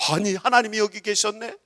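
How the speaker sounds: acted wonder, lifting at the end; holy astonishment. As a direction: ask it in amazed discovery. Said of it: "거룩하게 놀래보시기 바랍니다".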